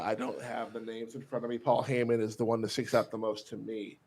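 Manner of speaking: ordinary talk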